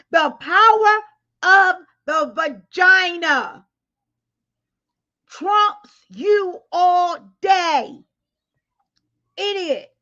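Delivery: stretched out, shouted high and loud; slow shout. Real, not acted. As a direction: hammer each word down at him, slowly. Scolding him through the phone.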